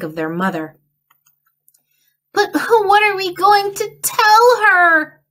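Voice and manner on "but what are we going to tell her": high, loud, slow wail. A narrator's reading. Bess wailing to her siblings, high and loud. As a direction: wail it out in dismay. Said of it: "wailed Bess".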